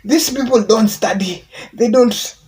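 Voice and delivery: high, loud mock complaint; frustrated rant. Benjamin Zulu complaining about youth, high loud mock rant. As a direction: whine that nobody studies. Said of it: "And he was like".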